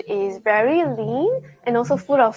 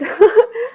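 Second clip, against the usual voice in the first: excited laugh; 11 semitones higher, 7 dB louder than usual. Real, not acted. Excited laugh at the big dish.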